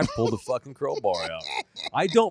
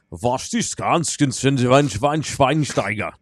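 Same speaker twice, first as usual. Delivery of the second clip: mock German babble, getting louder; fake German gibberish; 3 semitones higher, 6 dB louder than usual. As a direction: spout nonsense German, hammed up. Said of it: "I would pretend to be speaking Japanese or German".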